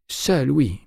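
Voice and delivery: high, choked pleading voice; frightened clerk. A narrator's reading. Terrified clerk under McCaleb's arm, high and pleading. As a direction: beg to be let go.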